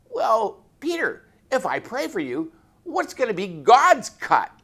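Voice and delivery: high, sly teasing question; playful tease. Retold or imitated. Reverend teasing the gambling sergeant about God's cut, high and sly. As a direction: needle him with a wink in the voice.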